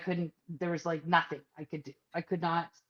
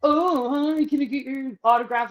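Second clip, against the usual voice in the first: higher, louder star-struck gush; schmoozing fans; 7 semitones higher, 8 dB louder than usual. Retold or imitated. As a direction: fawn over the TV stars. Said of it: "all just schmoozing this guy".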